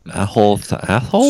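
tongue held, slurred and loud; holding tongue with fingers. Pretend. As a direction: mangle the words holding your tongue. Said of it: "Hold your tongue and say that".

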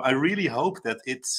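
normal talking voice

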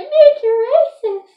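high, loud mocking yell; drawn-out imitation of a friend's yell; imitating the yell. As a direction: copy her shout back at her. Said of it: "but the way you said it".

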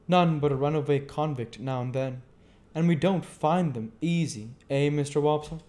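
a hint of easygoing Joe; Joe the blacksmith. A narrator's reading. Joe talks of convicts.

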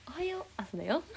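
high sing-song wake-up call; playful high alarm voice; mascot morning call. Pretend. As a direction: chirp it as a cartoon alarm clock. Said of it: "ゆなのそのモーニングコール欲しい".

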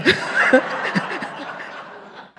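high breathless laughter at herself; real laugh. Real, not acted. Minister laughing while retelling a harsh sermon review. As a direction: laugh it off warmly, airy and high. Recalling the embarrassing sermon critique.

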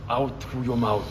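a playful mock Wim Hof egging her on to embrace the cold; Wim Hof impression. Partner coaching her through the ice bath, a light Wim Hof impression. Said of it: "I'm Wim Hof".

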